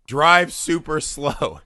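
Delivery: drawn-out, very slow "Drive super slow"; slow instruction. Retold or imitated. Telling his panicked buddy what to do, slowly. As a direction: stretch every word out slowly. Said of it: "I go".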